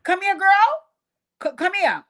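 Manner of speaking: put-on aunt summoning a child for a scolding; stern summons. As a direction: call the girl over, no nonsense. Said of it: "It was like".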